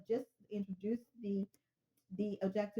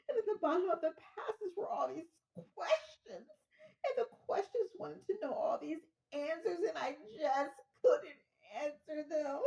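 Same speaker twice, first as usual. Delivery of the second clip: much higher, slow, half laughing half sobbing; hysterical caller; 11 semitones higher, slower than usual. Acted. Caller to the operator, high and laugh-sobbing in mock distress. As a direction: fall apart on the phone, laughing through mock tears.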